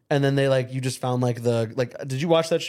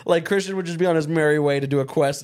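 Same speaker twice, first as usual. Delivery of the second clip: ordinary talk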